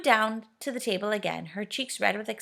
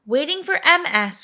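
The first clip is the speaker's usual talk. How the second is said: groaning; 8 semitones higher, 8 dB louder than usual. A narrator's reading. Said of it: "groaned Moonface".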